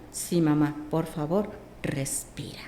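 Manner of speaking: low, fading into a whisper; soft pleading. Retold or imitated. Daughter softly pleading with mother to breathe, whispered. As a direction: gently beg her to breathe.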